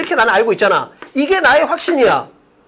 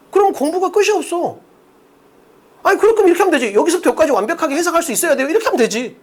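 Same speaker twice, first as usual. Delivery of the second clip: higher-pitched mock protest; mild exasperation; 5 semitones higher than usual. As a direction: protest as if fed up. Mimicking reaction to over-detailed explanation. (살짝 답답해하며 따지듯)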